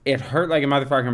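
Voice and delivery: ordinary talk, nothing marked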